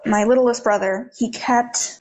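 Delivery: ordinary delivery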